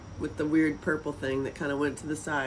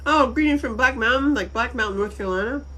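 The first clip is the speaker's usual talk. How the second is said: bright greeting; 7 semitones higher, 6 dB louder than usual. Real, not acted. Showing off her Southern accent.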